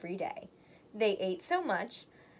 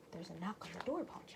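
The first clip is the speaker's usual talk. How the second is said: hushed aside; 5 semitones lower, 15 dB quieter, faster than usual. Acted. Aside whispered to listener.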